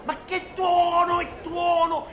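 high, indignant shout on TUONO; exasperated objection. Acted. Teacher rejecting the contestant's thunder, exasperated high cry. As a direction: throw the wrong word back in outrage.

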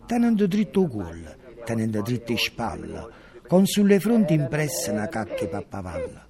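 loud, rhythmic stage recitation; hammering the lines out to an audience; theatrical declamation. Acted. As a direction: declaim it like a grotesque chant on stage.

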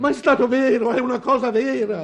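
high, loud insistence; desperate protest. Acted. Father protesting to doubters that it was true, desperate and loud. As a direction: burst out, pleading to be believed.

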